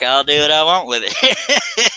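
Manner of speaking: laughing through the words; high, slow, laughing declaration. Real, not acted. Joking defiance, laughing.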